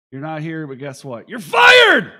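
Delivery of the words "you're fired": sudden high shouted firing; mock firing shout. Host jokingly firing absent cohost, big shout. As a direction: bellow it like a TV boss.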